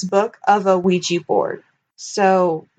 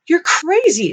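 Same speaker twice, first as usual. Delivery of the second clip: high scoffing "crazy"; a touch of a scoffing boy; lightly voiced Billy, scoffing; 8 semitones higher than usual. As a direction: toss it off like a boy scoffing at a friend. Billy's line read from the book.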